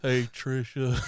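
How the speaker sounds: a lightly put-on Southern drawl, mocking Cage's movie accent. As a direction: tease the actor's bad Southern accent. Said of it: "because of the accent from where he was".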